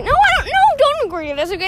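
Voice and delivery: very high yelp of no, then quick insistence; mock outraged. Refusing to agree to disagree on motorcycles, playfully indignant. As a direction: snap back at yourself, playfully indignant.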